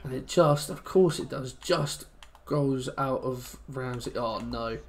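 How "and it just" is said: ordinary delivery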